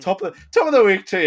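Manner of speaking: bright, high mock Irish lilt; hammed-up Irish greeting; Irish accent. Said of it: "You bad Irish accent".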